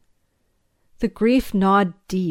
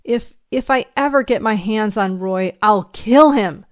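stammered threat bursting out loud; angry, grief-stricken outburst; vengeful anger; 3 semitones higher, 6 dB louder than usual. Acted. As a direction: build to a loud vow of revenge. Grieving man vows revenge.

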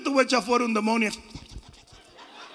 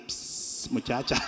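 low, soft psst and call; street catcaller; 12 semitones lower, 8 dB quieter, slower than usual. Acted. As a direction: imitate a street flirt's sly catcall. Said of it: "los tigres de la calle".

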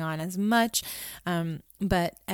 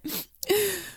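imitated sniffling gasp; 12 semitones higher than usual. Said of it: "he just sniffed and went".

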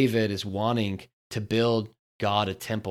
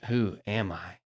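soft, humble asking; surrendered who am I; honest surrender; 2 semitones lower, 4 dB quieter than usual. Shown as a demonstration. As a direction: let the question sink quietly.

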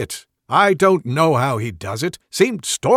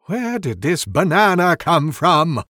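puzzled; 5 semitones higher, 7 dB louder than usual. Acted. Wizard confused.

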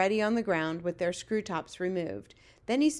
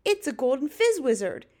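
louder, higher exclamation; excited cry; 5 semitones higher, 5 dB louder than usual. A narrator's reading. Giant boasts of his rare catch, excited cry. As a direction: burst out with it proudly. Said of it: "he cried".

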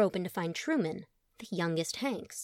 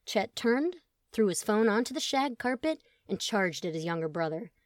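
ordinary delivery, nothing marked

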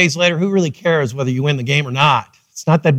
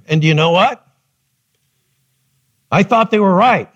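ordinary delivery

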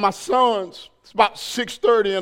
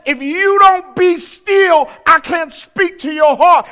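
booming; 5 semitones higher, 4 dB louder than usual. Acted. God's voice in sermon.

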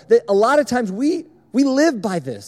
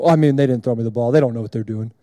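lower, deep grumbling monotone; monotone Kason; 8 semitones lower, faster than usual. Retold or imitated. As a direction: complain in a flat deep voice. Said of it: "he would always be kinda negative".

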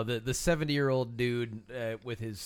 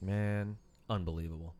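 flat, low, unimpressed "unbelievable"; mock amazement, bone dry; deadpan surprise; 7 semitones lower, 7 dB quieter than usual. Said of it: "that's your surprise look?".

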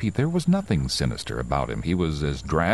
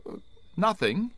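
shaken reply; 3 dB quieter than usual. Acted. Driver answers owner, shaken.

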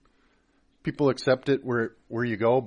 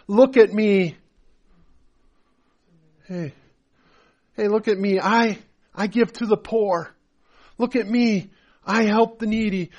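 mock bragging; 8 semitones higher, 4 dB louder than usual. Mocking show-off believers.

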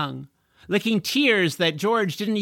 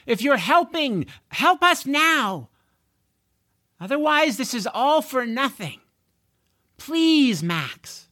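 high, raised desperate calling out; desperate plea; 10 semitones higher, 4 dB louder than usual. Acted. George pleading with unseen Max for help, desperate. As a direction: beg the unseen helper. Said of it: "George said to the air".

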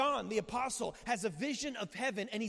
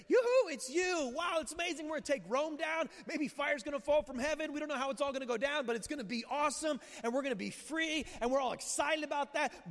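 excited crowd cheering; 5 semitones higher than usual. Retold or imitated. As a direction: play the crowd cheering their king. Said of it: "And so they're declaring".

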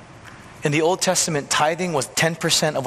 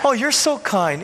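gushing admirer; 6 semitones higher than usual. Retold or imitated. Imagined praise from a neighbor.

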